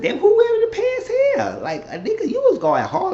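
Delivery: very high, teasing disbelief; high-pitched comic astonishment; mock incredulity. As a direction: squeak it as an amused jab. Teller's incredulous reaction, acted out.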